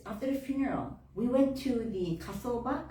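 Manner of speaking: ordinary talk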